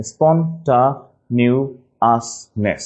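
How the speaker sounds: very slow "স্পন্টানিয়াসনেস" with a spelling-style "ta"; broken into syllables. Shown as a demonstration. Teacher helping class remember, "স্পন্টানিয়াসনেস" slowly split. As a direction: split "স্পন্টানিয়াসনেস" as spon, ta, nias, ness. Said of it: "একটু ভেঙে নিলে".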